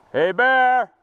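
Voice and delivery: loud yell at a bear; scaring off a bear. Real, not acted. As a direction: shout to chase the bear off. Yelling at bear.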